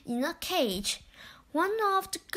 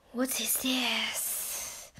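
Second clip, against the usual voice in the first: slow, soft whisper of a lake serpent; 3 semitones lower, 7 dB quieter, slower than usual. A narrator's reading. Serpent greets the children, slow whispered creature voice. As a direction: breathe it out like a hidden creature. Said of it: "said the serpent".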